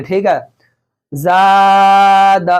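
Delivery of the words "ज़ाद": very long level aa in "ज़ाद", quick final da; long vowel, short ending. Shown as a demonstration. Teacher demonstrating madda and zabar, long then short. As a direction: hold "ज़ाद" on its first vowel, then release briefly. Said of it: "खींच के नहीं पढ़ेंगे".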